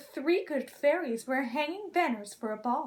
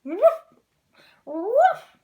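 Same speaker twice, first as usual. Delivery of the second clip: high, loud yelping woof; a dragon's failed bark; 8 semitones higher, 11 dB louder than usual. Acted. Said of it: "he tried to bark".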